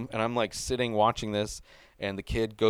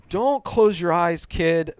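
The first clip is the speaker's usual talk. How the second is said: put-on high, loud pleading with the kid on screen; shouting warnings at a horror movie; tense yelling at the screen; 7 semitones higher, 6 dB louder than usual. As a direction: yell at the character not to close his eyes. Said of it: "I'm, like, so tensed".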